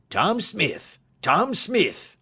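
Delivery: high, loud hail across the dark; calling out loud. A narrator's reading. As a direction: shout his name to reach the boat. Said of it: "calls out as loud as he could".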